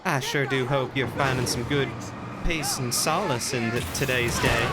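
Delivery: sweet Southern politeness; Southern accent. Acted. Voicing a Southern character.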